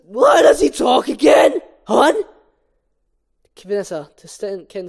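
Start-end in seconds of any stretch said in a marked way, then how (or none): 0.1-2.2 loud mock-indignant wail; Ken whining. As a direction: complain it loudly and high. Ken shouting in script.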